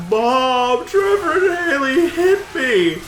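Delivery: loud, high fake bawl; mock child wailing. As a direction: tattle in a drawn-out, hammed-up wail. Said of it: "crying as hard as he could".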